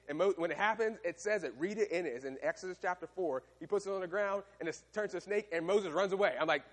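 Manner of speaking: normal talking voice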